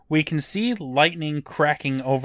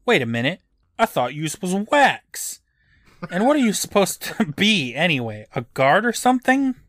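lightly voiced incredulous kid, rising higher; Eddie, cheeky challenge; 5 semitones higher than usual. A narrator's reading. Eddie challenges the guy he thought was wax, cheeky. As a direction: question him with mild, higher-pitched disbelief.

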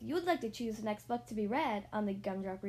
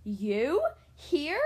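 louder, startled gasping question; gasped surprise; 3 semitones higher, 8 dB louder than usual. Acted. Mister Bird finding his wife alive, startled louder gasp. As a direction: gasp it in disbelief. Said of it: "gasped Mister Bird".

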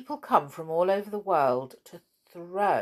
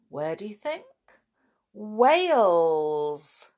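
usual speaking voice

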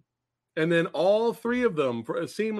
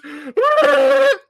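loud, high burst of laughter; cracking up hard; big laugh; 10 dB louder than usual. Real, not acted. Laughing hard at the film's silly scene, loud and high.

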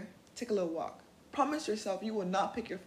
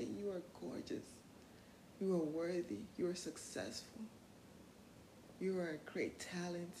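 soft mirror affirmations; 5 semitones lower, 10 dB quieter than usual. Shown as a demonstration.

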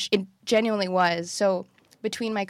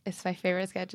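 ordinary talk

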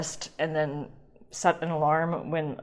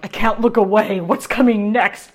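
loud, higher, hammed-up quote; mock gripped viewer; 4 semitones higher, 11 dB louder than usual. Imagined viewer glued to recipe video, loud mock amazement. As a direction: blurt it like a riveted viewer, hammed up.

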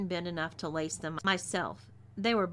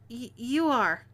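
higher, louder stammered submission; stammering; 4 semitones higher, 6 dB louder than usual. A narrator's reading. Slapped captive gives in to the boss, stammering. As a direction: trip on the first word, cowed. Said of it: "I stammered".

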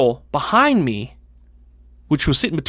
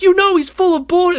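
put-on high, loud exasperated yell at the girl; retold shout; 5 dB louder than usual. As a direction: throw it at her, pitched up and loud. Shouting at the teacher.

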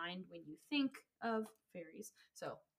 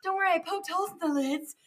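excited fairy hunter; 7 semitones higher, 6 dB louder, faster than usual. Pretend. Playful fairy hunt bit.

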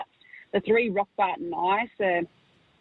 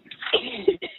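heavy chesty cough; coughing fit. Real, not acted. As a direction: hack out a rough cough. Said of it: "coughing up a lung here".

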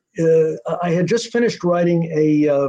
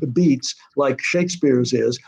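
usual speaking voice

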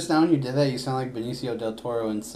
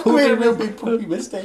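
higher, louder teasing echo; mocking repeat; 11 semitones higher, 6 dB louder than usual. Teasing a friend by echoing his silly phrase, higher. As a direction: parrot his words to tease him.

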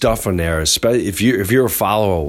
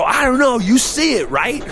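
mock amazement. Faking amazement on the ride.

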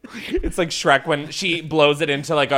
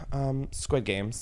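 ordinary talk, nothing marked

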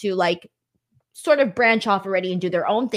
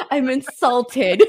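mock insulted; 2 semitones higher, 5 dB louder, much slower than usual. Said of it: "mock offense, laughing".

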